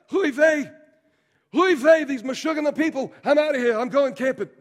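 put-on loud, high, exasperated Yiddish old man; Yiddish accent. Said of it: "I'll do Moses".

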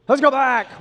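high, loud call to retreat; rallying cry. Acted. Israelites urging return to Egypt, rallying cry. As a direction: holler it to the whole crowd. Acting out the people's revolt.

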